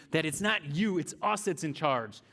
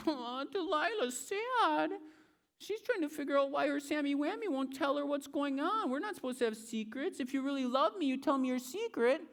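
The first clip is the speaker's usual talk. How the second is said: Delilah sulking; 12 semitones higher than usual. Acted.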